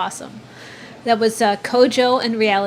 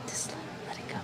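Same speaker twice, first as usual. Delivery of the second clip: hushed, voiceless whisper; barely audible whisper; whispered aside; 20 dB quieter than usual. Real, not acted. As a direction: whisper it, far softer, as a gentle nudge. Coaxing co-host to drop it.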